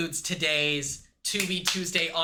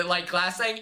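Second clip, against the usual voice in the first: usual speaking voice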